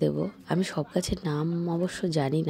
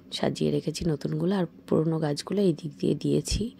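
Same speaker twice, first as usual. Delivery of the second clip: ordinary delivery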